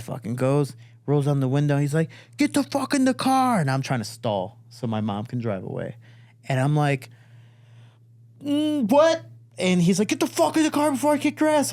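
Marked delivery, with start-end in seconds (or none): 2.4-3.6 high, strained mock yell; retold high-pitched angry command; angry dad order. Retold dad orders kid from van. 8.4-9.2 high-pitched stalling "what?"; mock-innocent high question; feigned incomprehension. Stalling kid feigns not hearing. 10.1-11.7 fast, high-pitched mock yell; rapid, strained retold threat; angry dad threat.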